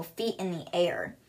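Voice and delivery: ordinary delivery, nothing marked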